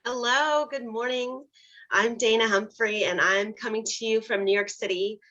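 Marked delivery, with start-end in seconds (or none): none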